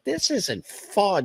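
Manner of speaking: put-on high, drawn-out kid's whine; complaining kid. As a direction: complain to dad like a let-down child. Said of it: "I'm just a kid".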